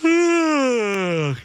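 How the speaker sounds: loud, high stretched-out yawn; exaggerated yawn. Husband hamming a huge yawn at his wife, loud yawn. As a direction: yawn big and loud on purpose. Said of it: "just went".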